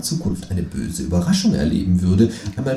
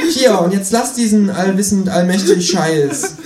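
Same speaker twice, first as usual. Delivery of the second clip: higher, louder annoyed kangaroo; irritated Känguru; 10 semitones higher, 4 dB louder than usual. Acted. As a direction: slam the money down. Känguru figure voice. (genervtes Känguru, höher und lauter)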